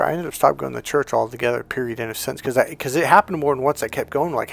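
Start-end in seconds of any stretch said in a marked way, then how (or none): none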